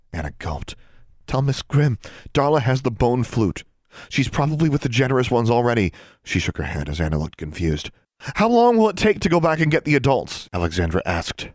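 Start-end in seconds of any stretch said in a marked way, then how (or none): 1.3-5.9 raised, pressing instructions; higher pitch, pushing each order out; urgent. A narrator's reading. Alexandra sends Anna to warn Miss Grimm, urgent. 8.2-10.5 high, loud, pressing question; urgent rush. A narrator's reading. Alexandra argues there is no time for adults, urgent rush. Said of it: "Her words came out in a rush".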